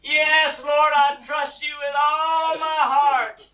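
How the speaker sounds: high, loud, stretched-out cry; desperate shouted pledge. Acted. Man on the cliff assuring God, shouting slowly. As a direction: swear it at full holler.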